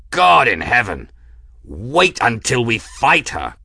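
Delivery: loud, rough exultant cry over the wind; exultant pilot. A narrator's reading. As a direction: shout it out, thrilled by the ship.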